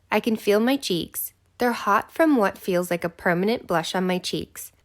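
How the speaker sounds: ordinary delivery, nothing marked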